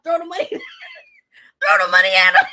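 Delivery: laughing. Real, not acted. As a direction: laugh while you tell it. Laughing while telling it.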